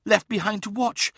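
high, strained voice of a seer; gasped. A narrator's reading. Uthizar, hand to temple, tells of the souls, gasped. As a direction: gasp it out in a high voice. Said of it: "gasped Uthizar".

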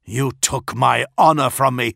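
dishonoured captain snarling at the traitor; snarled. A narrator's reading. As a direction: spit the accusation at him. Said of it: "he snarled".

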